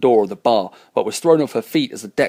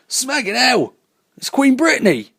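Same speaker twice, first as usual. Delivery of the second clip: high, startled shout. Acted. Crew member spotting the late Queen, shouting in shock. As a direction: blurt it out at the top of the voice. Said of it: "shouted what everyone else was thinking".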